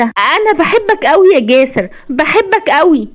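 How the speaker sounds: high, delighted declaration of love; happy. A narrator's reading. Wife tells husband she loves him, happy. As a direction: gush high, slowing on the repeat. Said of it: "وهي بتقول بسعادة".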